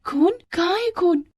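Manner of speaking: high, alarmed questioning; acted girlish shock; startled. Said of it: "Radha चौंक गई".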